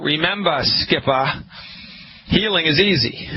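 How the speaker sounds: a light Boston accent slipping in. Real, not acted. Radio host reminding listeners, light Boston accent. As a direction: let the Boston accent creep into remember. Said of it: "here's my Boston accent coming out".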